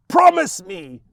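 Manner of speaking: loud, high shrieked demand. Acted. Mother gripping her son's wrist, shrieking. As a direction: scream it like a madwoman. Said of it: "she shrieks".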